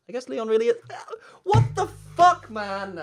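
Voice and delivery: squeaky high outburst. Acted. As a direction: shriek it in disbelief.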